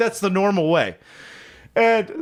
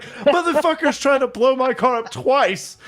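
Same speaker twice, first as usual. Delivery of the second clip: higher-pitched retold disbelief; mock alarm; 7 semitones higher than usual. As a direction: exclaim it as the incredulous car owner. Retold alarm at second blowtorch.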